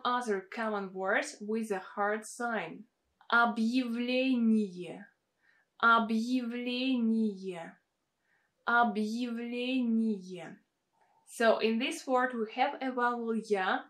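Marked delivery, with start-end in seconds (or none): 3.3-10.6 Объявление slow, unstressed я said as y-e. Shown as a demonstration. Teacher slowly modelling Объявление, я as y-e. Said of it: "I wrote it as y, e".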